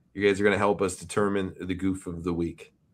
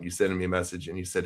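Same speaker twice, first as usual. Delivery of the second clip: normal talking voice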